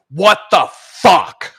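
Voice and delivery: loud, drawn-out, stunned boss anger; each word hammered out slowly; furious slow bark. Retold or imitated. Boss glaring at the coder who broke the site, drawn-out furious. CTO glares at him again.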